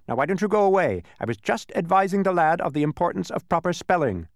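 high, loud bee voice. A narrator's reading. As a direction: shoo him off. Bee shooing humbug.

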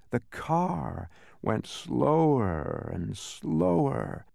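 ordinary delivery

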